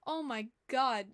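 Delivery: normal talking voice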